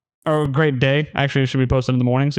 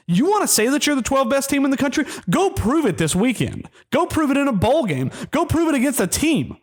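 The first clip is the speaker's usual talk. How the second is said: a furious challenge flung at the team; angry rant; 9 semitones higher than usual. Real, not acted. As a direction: pitch jumps high, each "Go prove it" hammered down hard. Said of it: "I've never been this angry".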